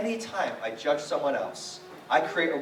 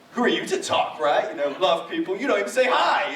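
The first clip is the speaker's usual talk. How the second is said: louder, rising indignation, building to a yell on the last words; indignant rant; 4 semitones higher, 7 dB louder than usual. Retold or imitated. Inwardly ranting at the pastor who ignored him, building to an indignant yell. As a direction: vent at the pastor as if he could hear you. Angry thought about the pastor.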